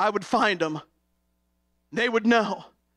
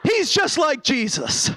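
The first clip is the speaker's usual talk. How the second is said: put-on child standing up; 10 semitones higher, slower than usual. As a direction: shout it like a small boy defending his dad. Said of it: "He stood up on the pew".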